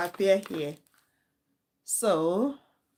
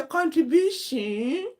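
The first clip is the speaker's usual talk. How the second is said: "Contribution" drawn out slow, higher, with a rising mock-posh tune; put-on accent; 7 semitones higher, slower than usual. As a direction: overdo the fake accent on "Contribution". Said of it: "the one that used to say".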